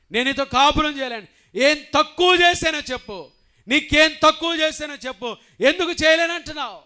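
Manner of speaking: loud, high pleading cry; anguished outcry. Acted. Husband crying out to his refusing wife. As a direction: cry out at her in despair.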